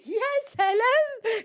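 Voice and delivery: very high, loud, slow sarcastic "يا سلام"; exaggerated disbelief wow; mocking wow. Real, not acted. Repeats mocking exclamation.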